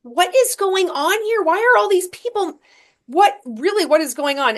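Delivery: a sudden, high cry of confusion; baffled inner thought. Retold or imitated. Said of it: "And I thought".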